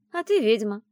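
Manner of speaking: wry retort. A narrator's reading. Friend countering heroine's objection, wry retort. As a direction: toss it back with a hint of scoffing. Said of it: "хмыкнула подруга".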